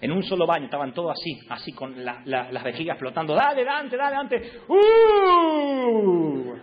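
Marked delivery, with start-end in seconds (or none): 3.3-4.5 high, loud mock call to hurry; calling through door. Family calling the son to leave the bathroom, loud. 4.7-6.6 high, loud drawn-out protesting groan; whiny teen groan. Retold or imitated. Teen in the shower groaning at his family, loud and drawn out.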